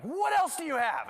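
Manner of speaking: high, loud mock interrogation; imitated teachers' demand. Teachers interrogating girl after the gum, loud imitated demand. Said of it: "And they're like".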